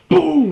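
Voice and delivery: loud shouted mock gunshot; gunshot boom. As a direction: yell it like a gunshot. Mimicking the gunshot in the scene.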